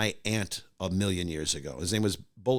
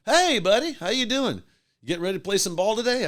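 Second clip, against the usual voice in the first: booming hearty greeting; 10 semitones higher than usual. Retold or imitated. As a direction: call out across the field, beaming. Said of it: "he goes".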